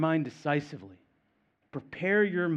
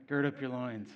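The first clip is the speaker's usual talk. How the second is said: softer, offhand use of an archaic phrase; deadpan casual delivery; mock everyday talk; 3 semitones lower, 5 dB quieter than usual. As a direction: toss it off as if it were normal chat. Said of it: "that's how we talk, right?".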